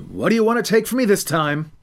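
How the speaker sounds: put-on fed-up teenager pushing back; exasperated teen. Boy confronting extortion.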